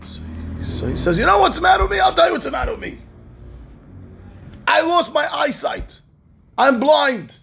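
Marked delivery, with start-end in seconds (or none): 1.2-7.4 high-pitched, wailing confession; anguished outburst. Retold or imitated. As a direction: cry out your misery at the man confronting you. Blind man's anguished outburst.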